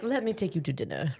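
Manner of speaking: put-on laughing French accent; lightly voiced flirty Frenchman. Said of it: "I can't stop doing the accents".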